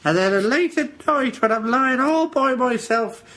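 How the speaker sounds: high, put-on storyteller voice; silly falsetto. Host invents a chat with the guest, silly falsetto. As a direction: gush through it in mock falsetto.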